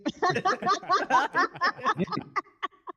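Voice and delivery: high burst of laughter; loud laugh. Real, not acted. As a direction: break up laughing. Laughing at the voice request.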